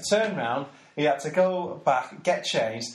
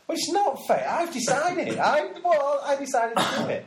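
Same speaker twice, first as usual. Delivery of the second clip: much higher, voicing Bob as he insists he chose it; Bob's defiant voice; 11 semitones higher than usual. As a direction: play Bob in a high, stubborn mock voice. Voices Bob defying fate.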